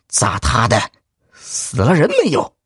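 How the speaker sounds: gruff magistrate. Acted. Magistrate questions clerk.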